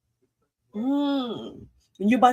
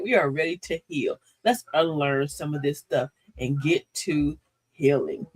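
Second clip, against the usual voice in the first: ordinary delivery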